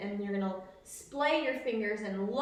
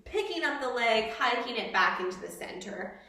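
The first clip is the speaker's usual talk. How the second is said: usual speaking voice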